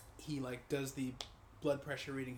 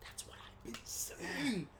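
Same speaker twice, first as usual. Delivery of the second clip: whispered vindication; 8 semitones higher, much slower than usual. Retold or imitated. Retold vindicated reply.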